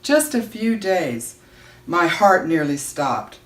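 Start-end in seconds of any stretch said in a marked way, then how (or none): 0.0-1.3 slow, higher, mildly tearful; sobbing. Acted. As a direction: draw it out through light tears. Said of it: "my mother sobbed".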